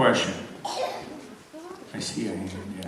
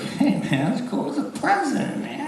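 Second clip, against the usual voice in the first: laid-back dude; 12 semitones higher than usual. Retold or imitated. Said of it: "I was like".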